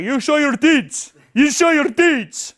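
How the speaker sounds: high, loud, slow mock French girl. Imitating the French contestant on a dating show, high, loud and slow. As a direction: ham up the blunt stripper. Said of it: "She's just like".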